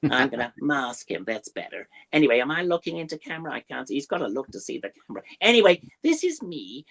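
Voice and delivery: high, chattery puppet beaver; hammed-up American beaver voice. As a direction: pipe up as a fussy little beaver puppet. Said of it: "he would talk a bit like that".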